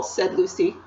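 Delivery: ordinary delivery, nothing marked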